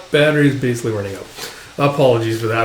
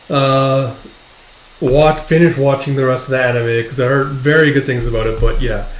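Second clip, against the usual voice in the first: ordinary delivery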